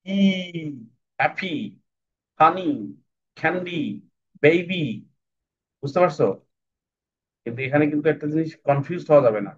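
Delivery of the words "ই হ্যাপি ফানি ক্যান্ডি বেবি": a long ই, then হ্যাপি, ফানি, ক্যান্ডি, বেবি with clear "ee" endings; final Y as "ee". Shown as a demonstration. Teacher showing how Y sounds, stretching ই and each word's "ee" ending. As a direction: let the final ই ring out in each word. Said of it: "ওয়াইটাকে কি বলতে হবে".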